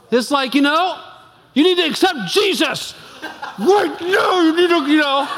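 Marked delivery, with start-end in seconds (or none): 1.5-5.3 high mock evangelist push; hammed-up hard sell; pushy preacher.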